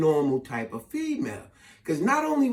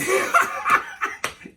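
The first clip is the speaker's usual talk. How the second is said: high-pitched mock laughing fit; imitated cracking up. Dancers cracking up after a bump, imitated high laughter.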